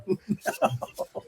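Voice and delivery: high, giggling no through a laugh. Retold or imitated. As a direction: laugh out the answer at your own cluelessness. Retold reply, laughing at himself.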